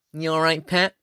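a hint of a put-on cashier greeting; angry woman cashier. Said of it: "she's like".

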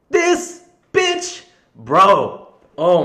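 loud, high burst of disbelief; exasperated outburst. Real, not acted. Venting to listeners about a rude nurse, exasperated loud outburst. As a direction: blurt it out, fed up with her.

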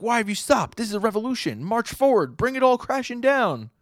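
high, loud zealous cry; voicing a crowd member. Crowd member urging the stopped marchers, high zealous cry. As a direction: urge the crowd forward fervently. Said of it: "somebody in the crowd is like".

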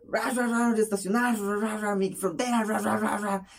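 high, unintelligible mock rant; imitated garbled yelling. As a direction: imitate a neighbor's words lost under engine noise. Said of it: "Me dice". (imitando gritos agudos e ininteligibles)

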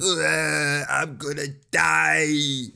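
high, loud doomed wail; slow, drawn-out mock-panicked cry; Kuwabara. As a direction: stretch it into a hammed-up dying yell. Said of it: "And Kuwabara's like".

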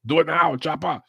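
high, forceful movie-hero parody; mock action-hero catchphrase. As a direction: push it out as a hammed-up action-film command.

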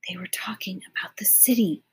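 hushed secret whisper. Acted. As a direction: whisper so no one hears. Lena hiding, thinking aloud.

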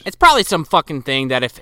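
usual speaking voice

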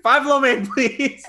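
high, hammed-up restaurant order; mock loud ordering. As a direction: call out the order, playfully imitating a friend. Mimicking friend ordering food loudly.

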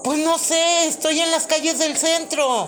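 loud, high, frantic plea; panicked caller. Acted. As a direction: beg for help in a panic.

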